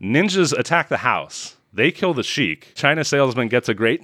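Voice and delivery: normal talking voice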